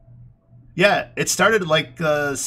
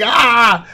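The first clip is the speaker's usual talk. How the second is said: snake scream; 9 semitones higher, 8 dB louder than usual. Retold or imitated.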